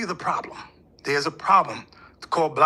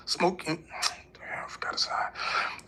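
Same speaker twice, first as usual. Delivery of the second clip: slow whisper to himself; whispered to himself, forgetting a singer's name; whispered aside; 7 dB quieter, much slower than usual. Real, not acted. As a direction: mutter it under your breath while searching for the name. Muttering while trying to remember.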